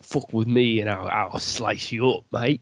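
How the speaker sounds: hint of an English road-man accent; lightly put-on British accent, menacing; mock London rapper. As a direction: threaten like a UK drill rapper, half joking. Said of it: "the English rappers that are coming out".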